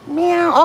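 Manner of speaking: high playful cat meow; copying a cat's meow; mock meow. Real, not acted. Imitating cat to cats.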